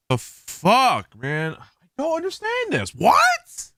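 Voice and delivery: baffled angry yelp; real frustration. Real, not acted. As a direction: blurt it out in disbelief, pitch climbing. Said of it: "I don't know why I'm getting mad right now".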